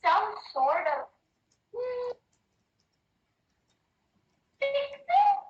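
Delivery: high, bright fairy voice calling for calm; fluttery fae peacemaker. Acted. Fae breaks up the bar fight, high and fluttery. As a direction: sing out like a flustered fairy hostess.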